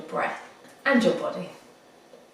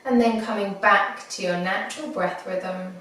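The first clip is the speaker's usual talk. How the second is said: guided meditation. Acted. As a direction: ease them gently into the breath. Leads a body scan meditation.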